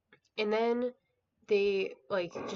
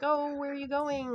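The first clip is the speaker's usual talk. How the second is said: lightly hammed-up pleading question; mock plaintive; 7 semitones higher than usual. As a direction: imitate a dismayed lover in a higher voice. Voicing upset woman as lover leaves.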